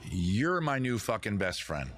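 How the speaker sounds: ordinary talk, nothing marked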